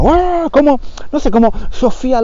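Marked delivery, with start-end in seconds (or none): 0.0-0.5 mock passionate scream. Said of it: "y grita".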